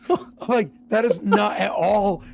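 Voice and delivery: high, loud disbelieving cry of what; incredulous outburst. Retold or imitated. Retelling his reaction to a friend, high loud disbelief. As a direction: shriek it in shocked disbelief.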